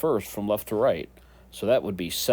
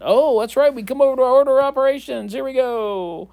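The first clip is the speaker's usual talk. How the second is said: high, loud, fast burst; hammed-up eureka moment, racing along; mock sudden realization; 13 semitones higher, 8 dB louder than usual. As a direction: exclaim it high and loud like it just hit you. Acts out suddenly remembering the rules.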